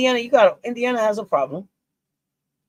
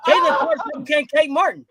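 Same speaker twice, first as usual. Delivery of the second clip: showman magician; 5 semitones higher, 7 dB louder than usual. Pretend. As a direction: boom it out like a stage magician. The bit done as a magician.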